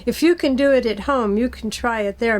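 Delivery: normal talking voice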